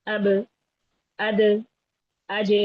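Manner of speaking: اب، اد، اج each with the last consonant bounced open; qalqalah drill. Shown as a demonstration. Teacher drilling qalqalah letters, echoing the endings of اب، اد، اج. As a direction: release each final stop at once in اب، اد، اج, pausing between. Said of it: "اس صفت کو کہتے ہیں صفت قلقلہ".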